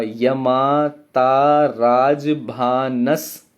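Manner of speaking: very slow, stretched syllables; chanting the mnemonic syllable by syllable; drawn-out recitation. Shown as a demonstration. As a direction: draw out each syllable of यमाता राजभानस. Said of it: "अब मैं शब्द दोबारा पढ़ रहा हूं".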